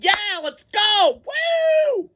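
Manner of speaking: very high slow whoop, imitating cheering fans; mock a crowd that's vibing, way up high; hyped fans. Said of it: "they're vibing and".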